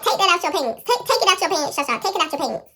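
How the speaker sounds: loud, high mock mom order; demanding mother. Mom orders child to empty pants, angry. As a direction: imitate a mom yelling a repeated command.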